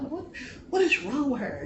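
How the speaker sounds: puzzled inner reaction, voice lifting; baffled. Retold or imitated. Child privately wondering about the dancing mother, baffled.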